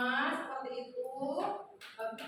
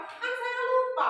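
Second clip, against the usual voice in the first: high, louder mock kid voice making an excuse; imitating student excuse; 10 semitones higher, 6 dB louder, slower than usual. Teacher retells the student Tama's excuse, high and whiny. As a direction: play the boy whining that he forgot. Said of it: "katanya gitu".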